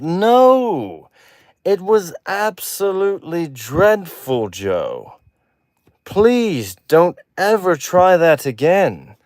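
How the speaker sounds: high, slow, emphatic British judge; hammed-up exasperated British critic; Simon Cowell. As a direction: cut in with a mock British dismissal. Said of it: "Simon Cowell's like".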